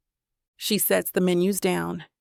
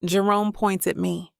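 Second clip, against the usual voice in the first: ordinary delivery, nothing marked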